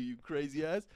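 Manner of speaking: ordinary talk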